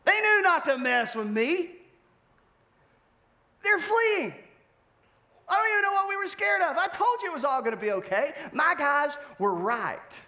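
high, cocky boasting voice; a smug king gloating at the prophet. Acted. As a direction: gloat like a king who thinks he has won. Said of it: "he looks at Jeremiah and says".

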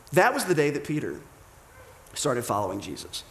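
usual speaking voice

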